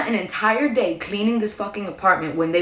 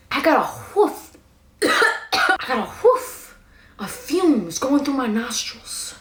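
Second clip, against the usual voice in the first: disgusted gag; 3 semitones higher, slower than usual. Real, not acted. Disgusted by the smell at the door.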